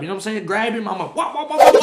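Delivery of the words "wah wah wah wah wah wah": loud, high mouth-noise flurry; imitated punches. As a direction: rattle off loud "wah" blows.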